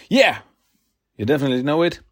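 ordinary delivery

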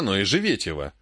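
plain reading voice

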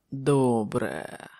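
low, satisfied grunt; low grumble. A narrator's reading. Wounded king after draining his wine, grumbling. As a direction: grumble it, sinking in pitch. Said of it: "пробурчав він".

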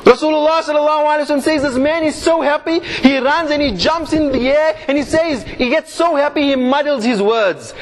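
stirring preacher building excitement; impassioned preaching. Acted. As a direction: paint the scene for the crowd with rising passion. Passionate storytelling sermon.

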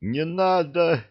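high frightened cry of Не надо. Acted. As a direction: yelp Не надо in alarm, pitched high. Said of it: "испугался Нет".

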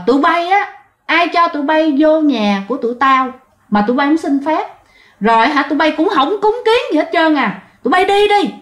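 high-pitched indignant ghostly scolding; mắng đuổi. Acted. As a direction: scold the intruders and drive them out, pitch climbing at the end. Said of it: "cất tiếng chửi". (giọng cao, mắng chửi xua đuổi)

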